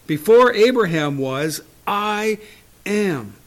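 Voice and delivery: loud, slow proclamation; ringing declaration. Retold or imitated. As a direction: declare it with force. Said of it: "Jesus said unto them".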